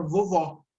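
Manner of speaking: "vovó" ending in an open aw sound. Shown as a demonstration. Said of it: "é aberto".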